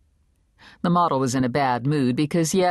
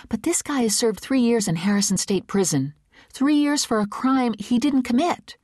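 put-on higher, quick eager young woman; young Rune, eager; 9 semitones higher than usual. A narrator's reading. Young reporter pitching her idea to the big boss, higher and eager. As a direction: pitch it fast and hopeful, a little nervous.

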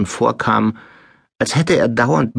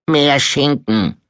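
slow, higher, drawn-out demand; greedy spoilt son; 4 semitones higher, slower than usual. A narrator's reading. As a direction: drawl it like a greedy lump of a boy. (träger, verfressener Sohn)

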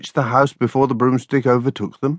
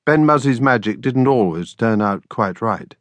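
ordinary delivery, nothing marked

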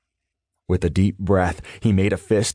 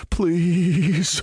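higher, mildly fearful please; whimpered plea; 8 semitones higher than usual. A narrator's reading. Terrified man pleading again, higher mild whimper. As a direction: beg once more, lightly whimpering. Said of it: "the man whimpered".

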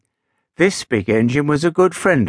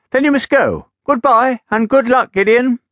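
high, louder little engine calling goodbye; little engine farewell; 10 semitones higher, 4 dB louder than usual. Acted. Little engine sending Gideon off, high and loud. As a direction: call out high and bright.

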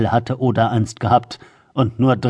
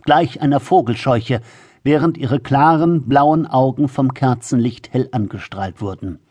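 plain reading voice

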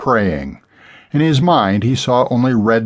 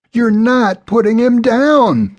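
high, loud scream of protest; desperate cry; 14 semitones higher, 5 dB louder than usual. A narrator's reading. Woman fighting to save her horse from being put down, screaming. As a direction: shriek in frantic refusal. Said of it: "the blonde cried".

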